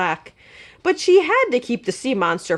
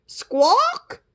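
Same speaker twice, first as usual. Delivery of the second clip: "Squawk!" high and rising like a question; asking squawk; 12 semitones higher than usual. Acted. Princess asks the bird to fly her down, a high rising "Squawk!". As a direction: squawk a hopeful plea. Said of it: "That meant, "Could you fly me down?".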